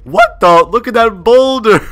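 loud, high amazed shout; astonished gamer yell; startled outburst. Real, not acted. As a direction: exclaim at a sudden huge sight. Startled by a boulder in game.

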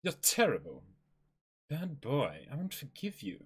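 usual speaking voice

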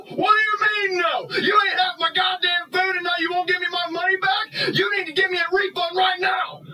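high-pitched shouted outrage; angry demand. Acted. Customer demanding a refund from the driver, angry. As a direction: yell it as an indignant customer.

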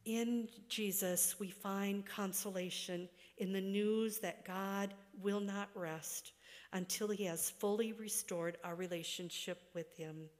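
ordinary delivery

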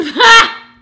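loud high laugh; bursting out laughing at herself; high burst of laughter. Real, not acted. Laugh before the repeat.

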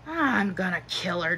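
soft, exasperated mutter. A narrator's reading. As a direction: mutter it under your breath. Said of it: "Jack muttered".

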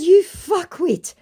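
high, loud, drawn-out insult; exasperated insult. Retold or imitated. Woman insulting the scammer, exasperated. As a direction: stretch the insult in mock outrage.

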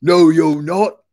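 loud, drawn-out troll refusal; growling troll. A narrator's reading. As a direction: growl it slowly and loudly. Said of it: "growled the troll".

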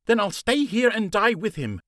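defiant snap. Acted. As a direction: throw it back at him, stubborn and hurt. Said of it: "she snapped back".

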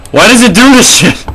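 high, loud panicked outburst; freaked-out cry. Real, not acted. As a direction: yelp it in real alarm. Freaked out by game visuals.

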